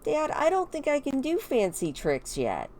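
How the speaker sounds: put-on high, unsure kid voice; doubtful daughter. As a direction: play a child doubting herself to Dad. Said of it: "She's like".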